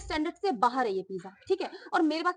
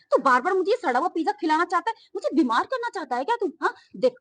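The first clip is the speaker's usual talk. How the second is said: usual speaking voice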